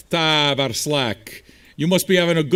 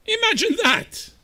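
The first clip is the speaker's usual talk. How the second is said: very high, drawn-out taunt; slow, sky-high mock amazement; mocking exclamation. Real, not acted. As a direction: stretch it out in a high squeal. Taunting a complaining viewer in chat.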